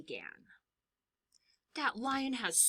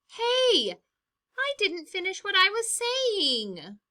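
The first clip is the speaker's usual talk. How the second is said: much higher, offended outburst; indignant protest; 11 semitones higher, 5 dB louder than usual. Acted. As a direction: protest being cut off. Lion interrupted in debate.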